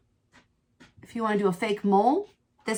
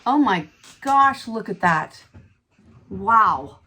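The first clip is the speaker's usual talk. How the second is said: thrilled by how precise it is; excited gasp; 6 dB louder, much slower than usual. Real, not acted. Vlogger thrilled by her new lip brush, loud and amazed.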